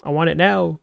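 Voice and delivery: ordinary delivery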